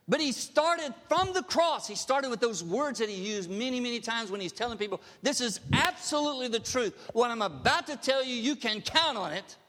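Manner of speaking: loud, rising preacher's push; loud preaching with conviction; fervent preaching. Acted. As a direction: drive the point home to the congregation. Preaching at full force.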